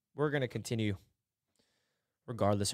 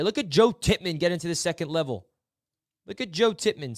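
ordinary talk

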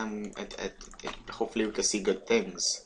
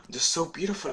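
gushing awe; 8 semitones higher than usual. Real, not acted. Gushing over flowers.

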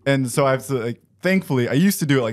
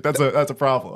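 usual speaking voice

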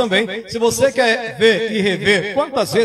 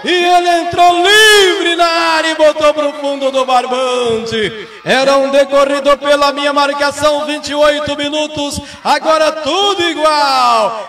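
explosive goal commentary; 5 semitones higher, 5 dB louder than usual. Real, not acted. Goal just scored, live commentary.

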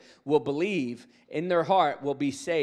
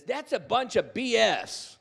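scoffing heckler; 8 semitones higher, 4 dB louder, slower than usual. Retold or imitated. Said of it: "and he says".

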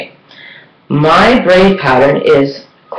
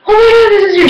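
all excited; much faster than usual. Retold or imitated. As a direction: squeal it like she's thrilled for you. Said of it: "she was all excited".

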